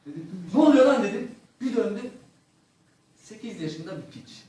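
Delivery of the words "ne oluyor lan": loud, startled yell over the shoulder; startled shout. Retold or imitated. Comic startled shout at the boy behind him. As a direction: bark it out in shock.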